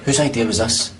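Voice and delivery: usual speaking voice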